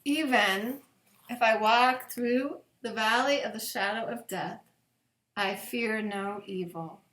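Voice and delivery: slow, reverent psalm reading with long pauses. Acted. Pastor reading Psalm twenty-three at bedtime, slow and reverent. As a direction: let each verse land gently, slowly.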